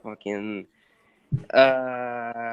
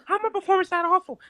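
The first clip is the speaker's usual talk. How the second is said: much higher, incredulous rising questions; mock Wahlberg disbelief; 9 dB louder than usual. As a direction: sputter in fake shock. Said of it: "Mark Wahlberg for Milo twenty-two".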